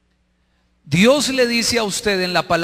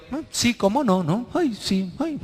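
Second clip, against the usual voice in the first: higher, sarcastic disbelief; exaggerated dismissive scepticism; mock scoffing; 5 semitones higher, 4 dB quieter than usual. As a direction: play a spouse brushing off an apology. Mocking disbelief at an apology, acted.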